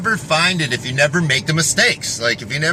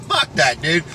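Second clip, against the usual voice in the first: usual speaking voice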